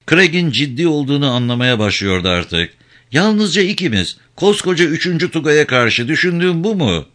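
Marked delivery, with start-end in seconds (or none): 3.1-7.1 high-pitched, incredulous challenge; appalled disbelief. A narrator's reading. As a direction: question a hopelessly mad plan. Pale woman appalled by the plan.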